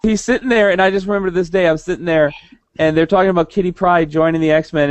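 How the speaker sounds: normal talking voice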